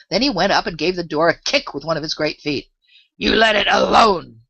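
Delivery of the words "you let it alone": panted. A narrator's reading. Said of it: "he panted out".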